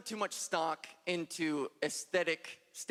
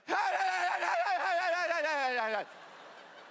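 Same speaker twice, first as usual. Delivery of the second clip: high, drawn-out wavering wail that fades away; the catcaller wailing as he flees; mock panicked wail; 9 semitones higher than usual. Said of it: "he biked off into the night just like".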